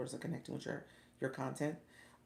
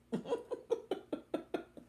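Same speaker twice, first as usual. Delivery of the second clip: high, wheezy giggling; helpless laughter. Real, not acted. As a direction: dissolve into high-pitched giggles. Overcome by her own mistake.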